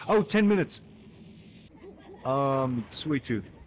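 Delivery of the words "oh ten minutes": surprised. Real, not acted. Reacting to time limit.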